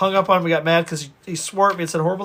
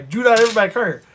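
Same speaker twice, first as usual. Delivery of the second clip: imitating an irate customer, pitched up; mock a raised-voice warning; retold angry warning; 4 semitones higher, 4 dB louder than usual. Unhappy customer telling everybody, retold angry warning. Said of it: "and yells".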